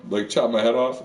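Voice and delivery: ordinary talk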